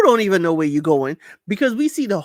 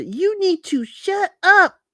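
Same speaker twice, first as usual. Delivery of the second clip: mock bossy order; 11 semitones higher, 4 dB louder, slower than usual. Retelling Ariella telling Marilia to hush.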